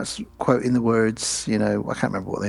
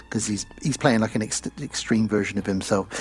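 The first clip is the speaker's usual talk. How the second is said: normal talking voice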